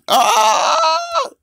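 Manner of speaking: sudden high, loud cry of pain; pained shout. Real, not acted. Gamer hit in the match, crying out loud in pain. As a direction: yelp out loud as the hit lands. Hurt in game, cries out.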